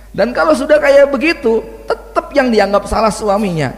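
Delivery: amused at his own joke; laughing. Real, not acted. Joke about blaming husband. (sambil tertawa geli)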